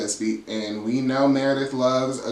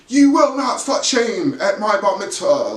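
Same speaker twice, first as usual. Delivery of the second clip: higher, louder frantic voice; mock screaming; 7 semitones higher, 5 dB louder than usual. Meredith losing it at her party, mock screaming. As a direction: rant like she's lost it. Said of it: "is screaming and".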